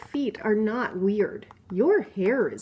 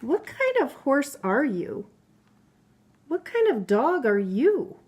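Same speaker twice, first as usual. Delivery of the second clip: lively, higher voice for each storybook animal; playful book characters; 3 semitones higher, 4 dB louder than usual. Acted. Mother reading a picture book to her child, lively character voices. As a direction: read the animal friends' squabble with bounce.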